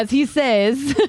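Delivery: slow mimicry of the character's accent; imitated Kenny accent. Co-host teases the narrator's lead-in, imitated Kenny accent. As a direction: let Kenny's accent slip in, drawn out. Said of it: "The accent kinda s-, kinda slips in".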